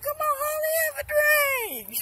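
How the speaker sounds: high squeaky coaxing voice. Retold or imitated. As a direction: wheedle her into a drink in a squeaky voice.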